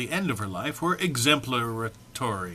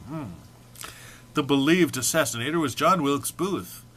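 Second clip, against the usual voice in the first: ordinary talk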